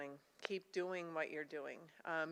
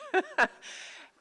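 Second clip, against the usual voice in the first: high, loud burst of laughter; 8 dB louder than usual. Real, not acted. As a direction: laugh out with the crowd. Laughing with the audience.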